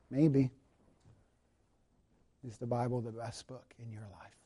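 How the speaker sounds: soft, hushed closing invitation; quiet prayerful appeal. Acted. Pastor leads closing prayer reflection.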